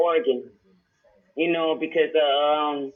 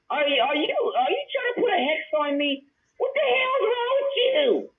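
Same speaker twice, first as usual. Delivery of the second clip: incredulous high rant; 10 semitones higher than usual. Real, not acted. Ranting at Carrie Ma on stream.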